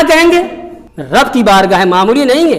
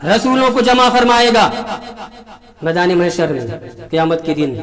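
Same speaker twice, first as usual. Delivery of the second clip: usual speaking voice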